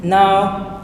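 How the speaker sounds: ordinary talk, nothing marked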